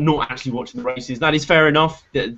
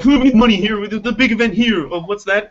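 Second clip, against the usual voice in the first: mock exasperated executives; 6 semitones higher than usual. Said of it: "'Cause they're all like".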